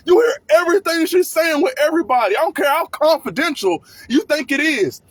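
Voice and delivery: normal talking voice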